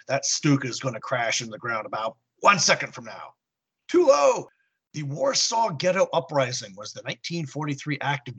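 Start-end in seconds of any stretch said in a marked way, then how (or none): 3.9-4.5 alarmed shout. Real, not acted. Warning about crashing plane.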